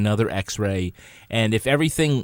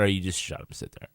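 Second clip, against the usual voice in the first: mock snapping; 4 semitones lower, 8 dB quieter, much faster than usual. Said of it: "No, I didn't say that".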